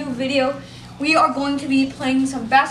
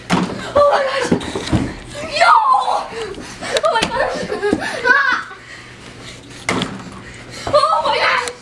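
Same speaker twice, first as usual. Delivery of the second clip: high, laughing shouts of disbelief; shrieking jackpot winner; 8 semitones higher than usual. Real, not acted. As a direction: shriek it like you just won. About to win the game.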